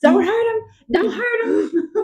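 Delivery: high-pitched mock panic; aghast. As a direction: plead in hammed-up horror. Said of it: "And Lucien is aghast".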